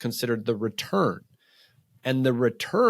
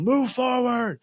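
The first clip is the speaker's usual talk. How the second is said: much higher, coach-like shout; yelling command; 12 semitones higher, slower than usual. Retold or imitated. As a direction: bark it to push him on. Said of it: "I kept yelling".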